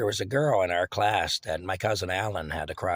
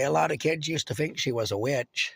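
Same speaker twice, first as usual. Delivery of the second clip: ordinary delivery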